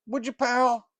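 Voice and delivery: fast and pushy, high up; Snickerdoodle voice. Acted. Snickerdoodle telling Morlock about the sugar, loud and high.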